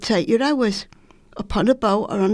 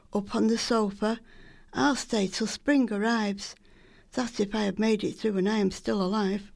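ordinary delivery

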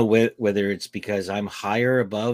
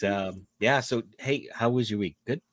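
ordinary talk

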